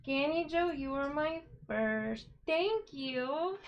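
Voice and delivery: high, bubbly and thrilled; so excited. Real, not acted. Streamer telling viewers about her first super chat, thrilled. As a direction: let the real excitement spill over. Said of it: "I'm so excited".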